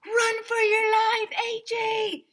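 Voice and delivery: very high, loud, slow yell; panicked shout. A narrator's reading. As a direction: scream the warning across the yard. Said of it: "shouted Neil".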